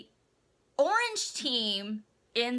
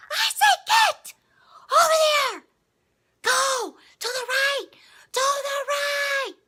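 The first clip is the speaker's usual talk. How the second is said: high strained shouting whisper; whisper yelling; 5 dB louder than usual. Retold or imitated. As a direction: yell it but keep it hushed. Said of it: "whisper yelling or something".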